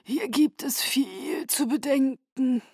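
slow, grave and solemn; gloomy. A narrator's reading. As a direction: weigh each word darkly. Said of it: "sagte Aro düster".

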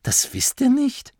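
high, incredulous lift; can't believe they don't know; baffled question. A narrator's reading. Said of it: "Verdutzt hob er die Brauen".

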